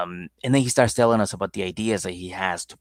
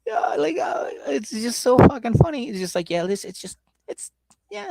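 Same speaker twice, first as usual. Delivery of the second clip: laughing; 3 dB louder than usual. Real, not acted. Laughing at the proposal plans.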